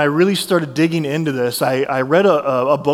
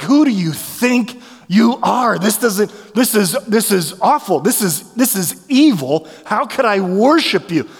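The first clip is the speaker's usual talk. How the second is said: retold anger at God; 5 semitones higher than usual. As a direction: accuse God, half pleading. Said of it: "I would get so mad at God".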